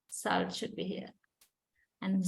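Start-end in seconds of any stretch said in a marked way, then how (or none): none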